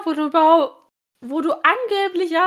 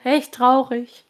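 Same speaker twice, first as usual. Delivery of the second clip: slow, lower mocking repeat; provocative; 5 semitones lower, slower than usual. Aunt repeating her jab at Julian, slowly and lower. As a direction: drag it out to rub it in. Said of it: "sagte seine Tante provokant".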